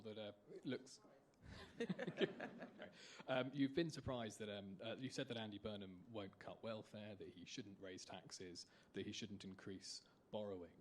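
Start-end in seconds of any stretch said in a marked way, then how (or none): none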